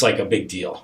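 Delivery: ordinary delivery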